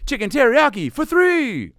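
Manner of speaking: high, loud drawn-out yell; mock commercial shout, stretched at the end; calling out. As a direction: holler it like a sports catchphrase. Mimics athlete yelling in a commercial.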